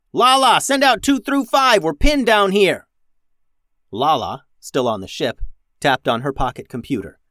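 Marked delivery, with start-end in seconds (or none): 0.1-2.9 high, loud urgent shout; yell into comms. A narrator's reading. Captain radios for backup under fire, yelling. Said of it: "Max yelled into her comms".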